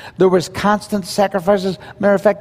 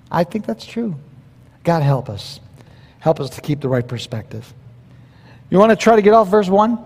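normal talking voice

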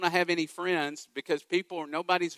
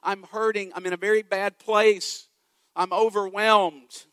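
crying out for help; 4 semitones higher, 5 dB louder than usual. Retold or imitated. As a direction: call up from the pit, growing more desperate. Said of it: "crying out from the bottom of the pit".

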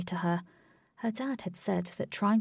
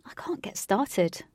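ordinary delivery

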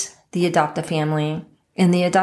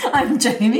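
laughing; 6 semitones higher than usual. Real, not acted. Laughing at name mixup.

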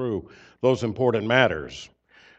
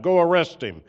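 mock stern order; 6 semitones higher, 5 dB louder than usual. Chief priests ordering their officers.

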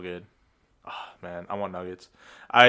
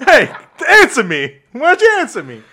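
higher, louder, pushy rustic guy; 7 semitones higher, 5 dB louder than usual. Acted. Rustic guy pressing a stranger to answer, pushy. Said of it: "I'm gonna be the, uh, rustic guy".

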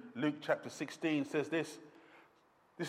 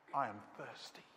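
faint voice of a dying man; 5 semitones lower, 14 dB quieter than usual. Acted. Jesus on the cross.

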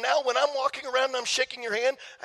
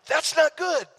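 higher, louder wry self-rebuke; mock self-scolding; 5 semitones higher, 5 dB louder, slower than usual. Real, not acted. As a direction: scold yourself with a wry grin. Self-critical aside in sermon.

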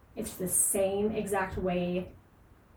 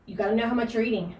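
ordinary talk

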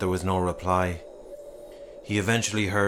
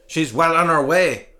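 grim retort; 7 semitones higher, 7 dB louder than usual. Acted. Grim reply from crowd.

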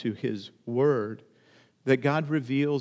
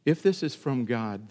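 ordinary talk

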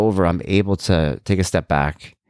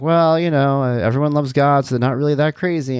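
lightly imitated, higher-pitched polite reassurance; mock small-talk voice, higher; Jason Bateman; 7 semitones higher than usual. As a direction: play the diplomatic sitcom dad, pitched up. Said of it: "Jason Bateman's like".

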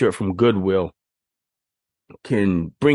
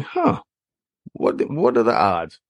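surprised huh. Retold or imitated. Surprised seeing alumni night.

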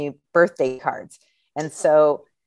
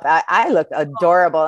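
ordinary talk, nothing marked

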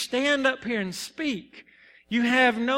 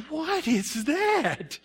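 slow, higher-pitched mock alarm; bewildered, drawn-out reaction; startled disbelief; 5 semitones higher, much slower than usual. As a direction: stretch it out in baffled surprise. Startled reaction to pounding heart.

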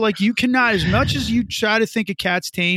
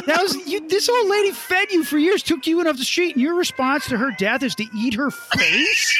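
outraged yell; 9 semitones higher than usual. Retold or imitated. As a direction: rage at the cat, voice up high. Outraged at cat eating owner.

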